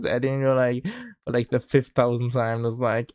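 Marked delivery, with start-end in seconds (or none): none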